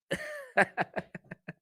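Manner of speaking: a real laugh squeaking up very high; laughing hard at his own confession. Real, not acted. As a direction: let the laugh break up into a squeal. Laughs after calling himself savage.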